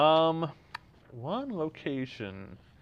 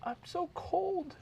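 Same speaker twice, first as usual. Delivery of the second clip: high, slow, shivering Rose in cold water; a man pitching up to play Rose; 4 dB quieter, slower than usual. Acted. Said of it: "we're shivering".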